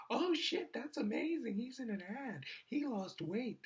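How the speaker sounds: high gushing imitation of a girl; high mock girl. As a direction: squeal it like an impressed girl. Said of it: "And she's like".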